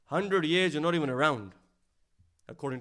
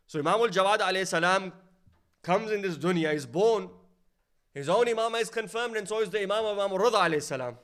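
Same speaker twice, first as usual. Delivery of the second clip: louder and higher, building triumph; rising fervent pulpit climax; rousing preaching; 5 semitones higher, 5 dB louder than usual. Acted. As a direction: lift the hall with each phrase.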